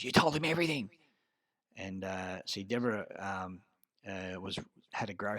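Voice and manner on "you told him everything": high, louder accusation thrown in passing; accusing. Retold or imitated. Deborah accusing him as she walks past, angry. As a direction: snap the blame at him.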